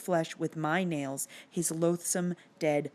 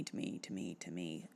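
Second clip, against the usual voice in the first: much lower, softer trailing repeat; fading mock echo; 13 semitones lower, 15 dB quieter than usual. Vlogger echoes the Phantom's possessive line, sinking low and soft. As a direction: let it fade away.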